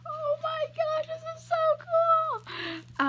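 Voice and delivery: high, slow, drawn-out squeal of delight; excited squeal. Retold or imitated. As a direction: gush like a starstruck fan. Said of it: "I was like".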